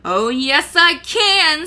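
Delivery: loud, rising, fierce crackle back; fierce retort. A narrator's reading. Sophie crackles back at Calcifer, fierce. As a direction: put a stop to the fight. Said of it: "Sophie crackled back with the ferocity".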